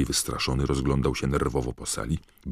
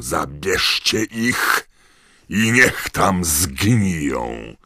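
high, very slow, drawn-out bellow; spitting, enraged command; furious roar; 5 semitones higher, 9 dB louder, much slower than usual. A narrator's reading. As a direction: roar every word at the top of the voice. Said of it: "ryknął do dementorów, pryskając śliną".

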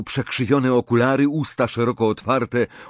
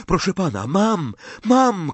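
excited shout; 8 semitones higher than usual. A narrator's reading. Harry finally getting water, excited shout. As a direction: shout with sudden relief. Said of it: "krzyknął".

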